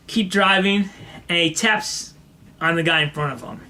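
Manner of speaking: ordinary delivery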